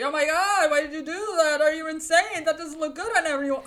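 loud, high shocked mom; mom freaking out. Retold or imitated. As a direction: shriek it like a horrified mother. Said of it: "She would say".

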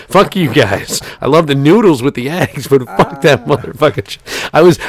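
ordinary talk, nothing marked

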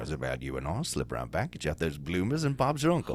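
low, soft mock-British come-on; hammed-up seductive British accent. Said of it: "a British accent".

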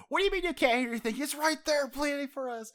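high, fast, exasperated squeal; rapid, high-pitched indignant outburst; Chopper. Retold or imitated. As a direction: imitate a small character protesting in disbelief. Voices Chopper protesting to the others.

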